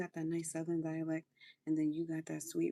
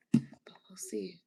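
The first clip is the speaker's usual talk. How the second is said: whisper; slower than usual. Real, not acted. Whispered doubtful aside.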